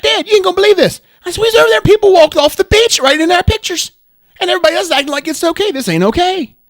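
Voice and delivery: loud, high, fast Southern-twanged rant; ranting to Dad in a Southern twang; angry Southern twang. Retold or imitated. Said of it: "Southern twang".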